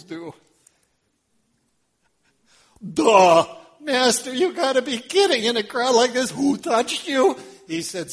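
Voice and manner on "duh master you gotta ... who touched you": high, loud scoffing disbelief; incredulous disciples. Acted. Disciples scoffing at Jesus, high and loud. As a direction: scoff like it's obvious.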